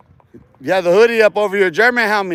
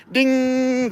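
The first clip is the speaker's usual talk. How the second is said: higher, sung-out ding like a little bell; 5 semitones higher than usual. Retold or imitated. Mocking the trolls by chiming like a bell. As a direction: ring it out like a doorbell. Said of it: "northern bell ringing".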